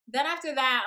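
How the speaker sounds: ordinary talk, nothing marked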